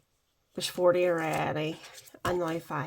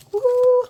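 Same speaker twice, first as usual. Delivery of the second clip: whoop; 13 dB louder than usual. Real, not acted. Finishes counting the challenge.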